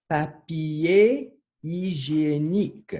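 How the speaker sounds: "Papier hygiénique" very drawn out, high and loud; slow word modelling. Shown as a demonstration. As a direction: model "Papier hygiénique" slowly and loudly for learners. Teacher models long new word.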